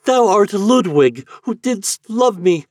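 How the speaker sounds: high, loud, slow repeated cry; cried out in recognition. A narrator's reading. Eloise cries out again to Ludwig, high and loud. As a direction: say it again, overwhelmed. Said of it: "cried Eloise".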